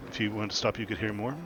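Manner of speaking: ordinary talk, nothing marked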